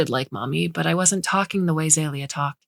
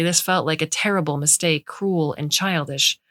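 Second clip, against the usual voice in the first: ordinary delivery, nothing marked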